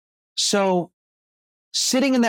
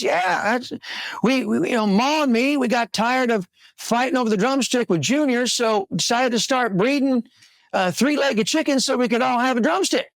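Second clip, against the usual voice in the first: country farmer; 6 semitones higher than usual. Acted. Farmer explains in joke.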